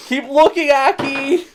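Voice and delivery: put-on high giggly girl voice; high playful voice.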